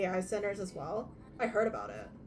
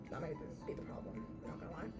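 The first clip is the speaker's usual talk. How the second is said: whispered; 4 semitones lower, 14 dB quieter, faster than usual. Real, not acted. Muttered aside.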